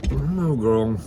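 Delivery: ordinary talk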